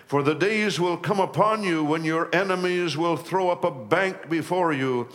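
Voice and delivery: preacher reading scripture aloud. Acted. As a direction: proclaim the verse like Jesus grieving. Reads Jesus' lament aloud.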